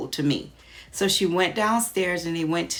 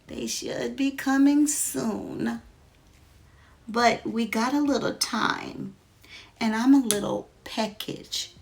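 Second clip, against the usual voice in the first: put-on mysterious old man; 6 semitones higher than usual. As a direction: take your time, sly and relaxed. The man checking his watch.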